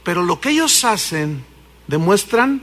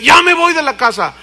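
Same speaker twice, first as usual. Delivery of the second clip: higher, louder fed-up wife; wife's voice; 6 semitones higher, 4 dB louder than usual. Acted. Wife tells husband she is leaving, fed up. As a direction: announce you're leaving him.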